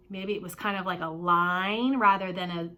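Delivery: ordinary talk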